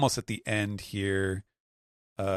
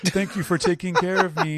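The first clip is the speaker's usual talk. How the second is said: high, mock-tender little voice; little ship voice; 11 semitones higher, 5 dB louder than usual. Dying ship thanking the crew, high, hammed-up tender voice. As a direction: voice the ship's goodbye sweetly. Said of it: "being like".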